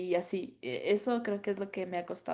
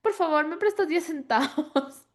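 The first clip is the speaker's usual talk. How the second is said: high, slow mock begging; pleading child; 7 semitones higher, 4 dB louder than usual. Child asks the teacher for ten cents, in high, slow mock pleading. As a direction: plead sweetly and slowly like a kid. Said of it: "nos decían".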